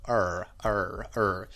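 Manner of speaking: ER repeated as a held hard American R; rhotic R sound. Shown as a demonstration. Teacher showing the American instinct, hard rhotic 'er'. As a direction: drone the R in each 'er'. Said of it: "shut that instinct to go".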